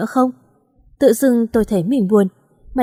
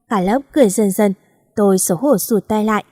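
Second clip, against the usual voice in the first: ordinary delivery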